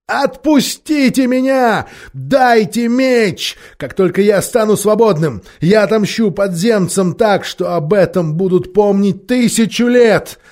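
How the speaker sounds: shouting. A narrator's reading. Bound prisoner demanding release and a sword, voice breaking into shouts. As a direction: cry out for freedom and vengeance. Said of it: "Голос пленника перешёл в крик".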